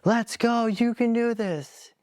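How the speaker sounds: retelling the crowd roaring a runner home; crowd cheering. Retold or imitated. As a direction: yell it like fans at the track rail. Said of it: "I hear the crowds banging on the side".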